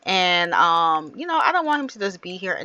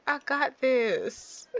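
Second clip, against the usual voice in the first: slow drawn-out promise; 5 semitones higher, 5 dB quieter, much slower than usual. Pretend. Miracle again, drawn out.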